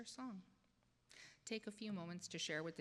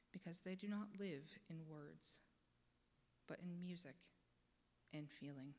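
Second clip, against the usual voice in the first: soothing voice that invites the congregation to close their eyes; guided meditation; 5 dB quieter than usual. Acted. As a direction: ease them gently inward, dropping to a whisper at times.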